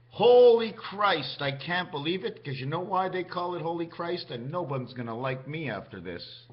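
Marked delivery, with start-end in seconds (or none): 0.1-1.4 slow, loud, higher cry of disbelief; loud exclamation. Acted. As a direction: belt it out, stretched, stunned.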